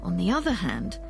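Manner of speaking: normal talking voice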